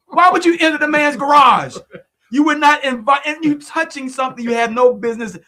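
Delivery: high, loud indignant rant; loud exasperated disbelief; outraged scolding. Real, not acted. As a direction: scold her like she's right there. Host scolds trespassing woman, outraged.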